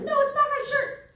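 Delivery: high squeaky Addie. Retold or imitated. Young freshman denying it to the comic, in a squeaky high voice. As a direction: pitch up into a small young girl insisting.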